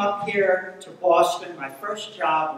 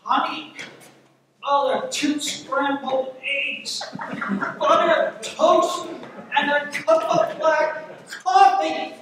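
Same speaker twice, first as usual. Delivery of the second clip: put-on loud, high-pitched old man ordering; 8 semitones higher, 6 dB louder than usual. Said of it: "this old man comes up to the counter".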